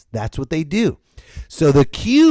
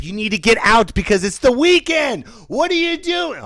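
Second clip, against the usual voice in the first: ordinary talk, nothing marked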